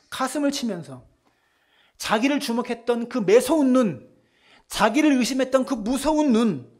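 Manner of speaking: high, loud rising fervor; preaching at full force. Real, not acted. Preacher driving home Peter's weeping, fervent and loud. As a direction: build the sermon to a peak.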